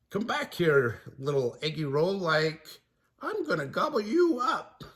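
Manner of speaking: high, goofy Shaggy voice calling after food. Acted. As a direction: coax the egg roll like a hungry goof. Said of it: "Shaggy called".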